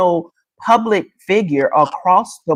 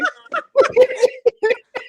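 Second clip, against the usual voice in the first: high laughter; 14 semitones higher than usual. Real, not acted. Host laughs at age reveal.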